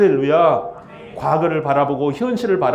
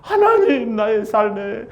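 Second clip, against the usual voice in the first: much higher, slow, fervent prayer voice; pleading prayer; 8 semitones higher than usual. Retold or imitated. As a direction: beg God earnestly for help, high and stretched. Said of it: "번역을 기다립니다". (높고 느린 간절한 기도)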